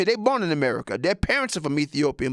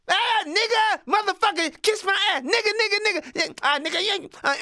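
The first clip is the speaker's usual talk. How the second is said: put-on rowdy bus kids. Rowdy kid yelling insults across the bus, high hollering.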